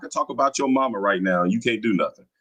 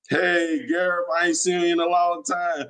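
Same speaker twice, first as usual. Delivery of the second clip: mock-friendly greeting; 5 semitones higher than usual. Real, not acted. Streamer spots his troll in the comments, mock-friendly greeting. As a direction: greet a heckler like a long-lost pal.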